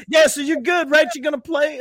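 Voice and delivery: high, loud mocking coach voice. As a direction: ham up the eager coach checking in. Mocking coach's eager question.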